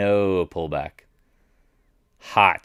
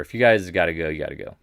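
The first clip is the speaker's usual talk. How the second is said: normal talking voice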